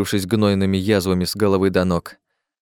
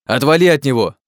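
loud, higher furious yell; angry shout; 5 semitones higher, 5 dB louder than usual. Acted. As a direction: bark at him to back off. Oleg pulling Dick off victim.

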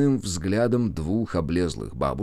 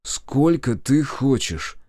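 slow, higher, grudging question; through gritted teeth; 6 semitones higher, 3 dB louder, much slower than usual. A narrator's reading. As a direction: squeeze it out slowly, irritated. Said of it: "процедил он". (процеживая сквозь зубы)

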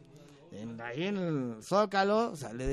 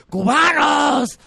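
shouted call, high and drawn out; arenga desde el balcón; 9 semitones higher, 10 dB louder, much slower than usual. Acted. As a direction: hail a huge crowd from a balcony, stretching the word. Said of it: "salen al balcón y les dice". (arenga a gritos, alargando las vocales)

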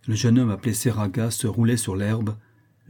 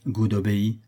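plain reading voice

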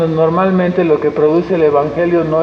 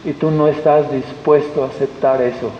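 normal talking voice

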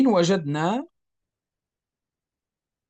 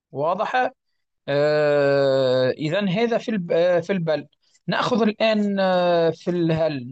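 normal talking voice

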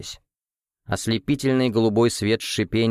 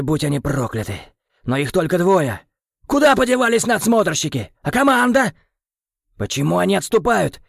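angry ranting; 5 semitones higher than usual. Acted. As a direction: rage at the betrayal. Krem raging at mutiny.

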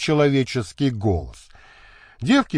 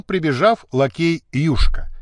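ordinary delivery, nothing marked

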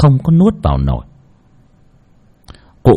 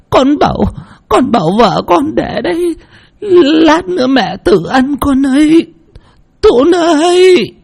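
much higher, frail voice calling out to her son; a desperate mother begging her son for help; old mother imploring. A narrator's reading. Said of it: "khẩn khoản". (giọng bà cụ khẩn khoản gọi con)

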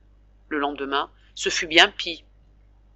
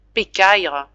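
ordinary delivery, nothing marked

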